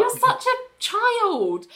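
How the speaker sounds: high, slow, drawn-out reproach; mock scolding. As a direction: stretch it out in exasperation. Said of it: "And I'm like".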